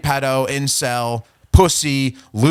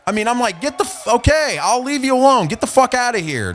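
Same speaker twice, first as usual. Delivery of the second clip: higher-pitched, swooping jeer; mock shooing taunt; 8 semitones higher, 4 dB louder than usual. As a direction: shoo the nerd away mockingly. Reads out his taunting replies.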